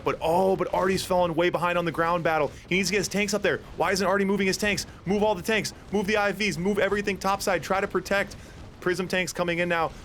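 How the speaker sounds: agitated, high-pitched urging; urgent commentary. Real, not acted. Caster urges Artie to move everything topside, agitated. Urgent call as player falls behind.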